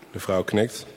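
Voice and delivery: plain reading voice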